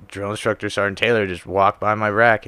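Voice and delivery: ordinary talk, nothing marked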